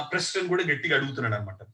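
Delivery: ordinary delivery, nothing marked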